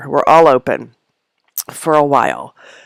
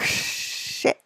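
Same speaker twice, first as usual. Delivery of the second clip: breathless whispered shit; hushed panicked swear; whispered curse; 11 dB quieter than usual. Retold or imitated. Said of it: "then I'm like".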